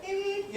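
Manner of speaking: high, soft beep beep like a little horn; mock horn beep. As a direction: chirp it like a tiny car horn. Imitating a car horn.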